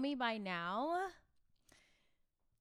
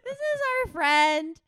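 hammy introduction; 3 semitones higher, 10 dB louder, slower than usual. Acted. Hammy slow guest introduction.

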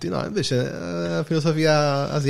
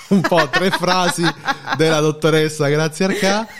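words through a laugh; 6 semitones higher than usual. Real, not acted. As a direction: laugh while you say it. Jokes about a doctor.

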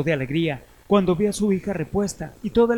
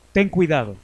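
louder, stern warning; grim reaper voice; 7 dB louder than usual. Acted. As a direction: warn him like Death itself. Death warns the godson.

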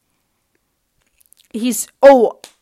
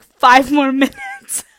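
drawn-out plea breaking into laughter; slow, giggly begging; laughing plea; 3 semitones higher, 4 dB louder than usual. Retold or imitated. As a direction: laugh halfway through the begging. Pleads with bouncer through laughter.

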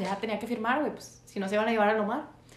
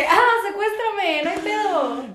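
mock carefree; 8 semitones higher, 4 dB louder, slower than usual. Mocking friend's careless attitude.

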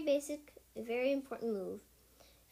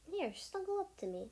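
tender comfort; 7 semitones higher than usual. Acted. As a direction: soothe a sleepless kit. Mother cat comforts sleepless kit.

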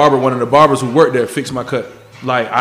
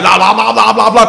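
mock gibberish; 7 semitones higher, 7 dB louder than usual. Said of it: "the bro was like".

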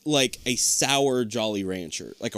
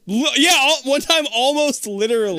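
mocking echo; 13 semitones higher, 6 dB louder than usual. Mockingly echoes friend's words.

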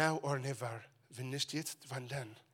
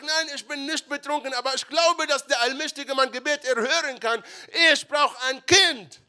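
high, loud, desperate plea; pleading woman; 10 semitones higher, 11 dB louder than usual. Acted. Hannah pleading with the priest for a child, desperate. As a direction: beg with everything she has.

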